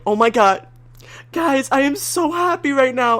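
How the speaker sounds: high, giddy retold squeal of joy; retelling own overjoyed message in the chat; gushing. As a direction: act out bursting with happiness. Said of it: "I'm like".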